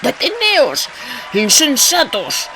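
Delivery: high, desperate shout. A narrator's reading. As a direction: cry out to stop them. (grito desesperado)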